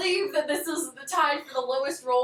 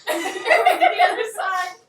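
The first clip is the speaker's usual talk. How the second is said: laughing; 5 semitones higher, 8 dB louder than usual. Real, not acted. Breaks into laughter mid repeat.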